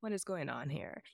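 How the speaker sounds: very quiet suspicious aside; soft wary murmur at a happy montage; hushed suspicious. Retold or imitated. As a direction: mutter it under your breath, wary. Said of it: "and I'm like".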